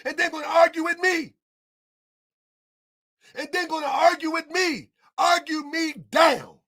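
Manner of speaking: furious at those selling him lies; angry rant. Real, not acted. Escalating rant against opponents.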